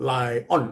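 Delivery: "lion" drawn out as "lai-ON", with a full O; Thai students' mispronunciation. Retold or imitated. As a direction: say "lion" as Thai learners do, ending in "on". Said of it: "นักเรียนไทยมักจะอ่านว่า".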